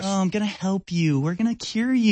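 high, sugary fake-caring voice; mock helpfulness. Disguised enemy offering a cure, mock helpfulness.

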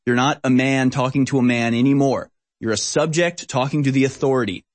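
ordinary delivery

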